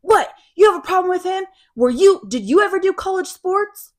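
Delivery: put-on loud, high drunk challenge; drunk wife. 'cause she's drunk.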